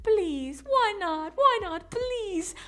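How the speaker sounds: very high mock whine; hammed-up high-pitched begging; whining pleader. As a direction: imitate a man pleading like a child. Voices whining pleading man.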